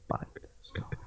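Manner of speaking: breathy whispered thought; hushed inner guess. Retold or imitated. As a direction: whisper it to yourself like a secret guess.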